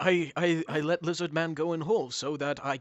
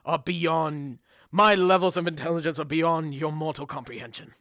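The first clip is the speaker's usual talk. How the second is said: booming demon lord; 5 dB louder than usual. Acted. GM voices menacing dog-god.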